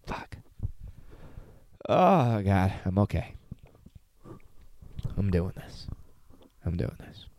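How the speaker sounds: quiet, choked self-steadying; holding back tears. Real, not acted. Son recording a goodbye to his dad, fighting tears softly. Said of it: "I'm crying".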